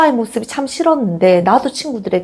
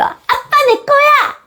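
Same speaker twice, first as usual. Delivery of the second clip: put-on much higher, childish claim on daddy; possessive little child. Child claiming dad from mom, high possessive squeal. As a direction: squeal it like a toddler grabbing dad. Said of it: "이렇게 말하는 아이 있어".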